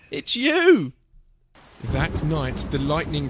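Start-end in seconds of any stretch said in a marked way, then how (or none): 0.1-0.9 high, loud reveal; gleeful answer; amused exclamation. A narrator's reading. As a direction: call it out in merriment. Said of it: "they laughed and said".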